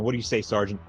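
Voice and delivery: ordinary talk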